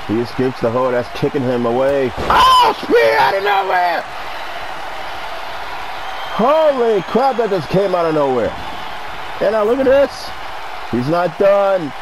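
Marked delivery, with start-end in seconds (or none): 2.2-4.0 sudden high yell of surprise; excited shout. Real, not acted. Sudden surprise move in match. 6.4-11.9 wrestling commentator. Acted. Live play-by-play of the match.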